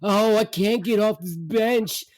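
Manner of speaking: high, slow, hammed-up moan; moaning mother. Mother rocking on the bench, moaning. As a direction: imitate a mother wailing in distress.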